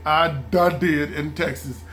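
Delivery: ordinary talk, nothing marked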